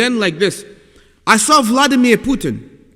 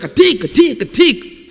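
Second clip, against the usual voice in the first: high, angry fake-Russian gibberish; imitating an angry leader in made-up Russian; mock angry Russian; 6 semitones higher than usual. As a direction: bark pretend Russian like a furious Putin. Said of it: "like he was angry like that".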